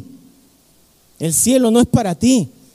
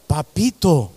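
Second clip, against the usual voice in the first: tender papito; 7 semitones lower, slower than usual. Acted. Tender name for God the Father.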